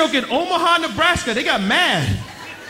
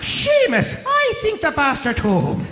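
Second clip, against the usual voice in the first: put-on Irish accent. Irish gangster voiced.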